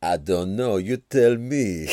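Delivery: loud, put-on French accent; slow, hammed-up French accent. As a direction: answer in a comic French accent. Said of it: "I can't even do a French accent".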